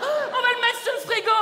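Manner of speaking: proud parent. Acted.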